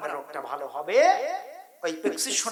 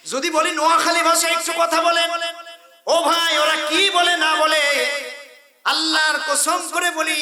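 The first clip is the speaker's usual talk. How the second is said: higher, louder preaching; full-force preaching; 9 semitones higher, 7 dB louder than usual. Real, not acted. Preacher rouses the crowd, high and loud. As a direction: call it out to the crowd.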